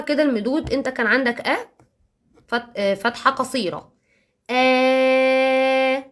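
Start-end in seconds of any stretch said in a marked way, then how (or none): 4.5-6.0 آ stretched into one long open a; آ sung out long for a child; long vowel demo. Shown as a demonstration. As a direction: hold آ as a long drawn-out vowel. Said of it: "طويلة، صوت طويل كده".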